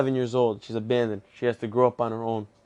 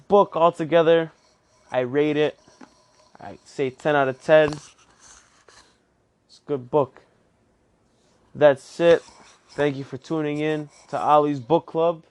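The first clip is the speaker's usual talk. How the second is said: upbeat outro; 3 semitones higher, 5 dB louder than usual. Acted.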